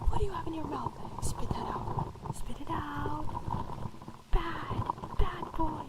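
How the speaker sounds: scolding a pet dog, whispered; stern but playful whisper to a dog; whispered asmr role play. Acted. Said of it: "the pet dog A S M R roleplay".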